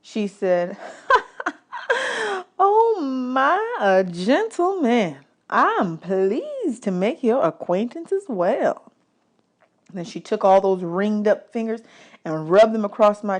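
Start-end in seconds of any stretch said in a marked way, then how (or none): 1.7-2.5 high, light lady's laugh; a bright trill of a laugh. Acted. Singer laughing at the polite boy. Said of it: "She laughed". 2.6-8.9 higher, slow, delighted lady's voice; charmed lady singer. Acted. Singer charmed by the boy's manners, high and slow.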